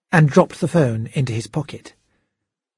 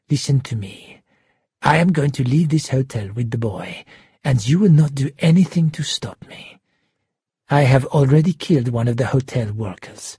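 cold, deliberate threat in a character voice; menacing shark villain. Acted. Shark giving orders to Schubert by phone, menacing. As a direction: play a controlled, menacing hostage-taker.

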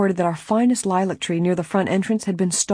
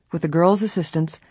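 ordinary delivery, nothing marked

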